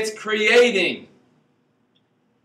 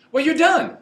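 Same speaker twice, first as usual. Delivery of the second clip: emphatic quip; 10 semitones higher, 5 dB louder than usual. Real, not acted.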